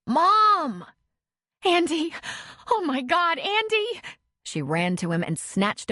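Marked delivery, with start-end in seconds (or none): 0.1-0.8 high, loud yell for mom. A narrator's reading. Boy running to mother. 1.6-4.0 high frantic cry of relief. A narrator's reading. Mother sees lost son.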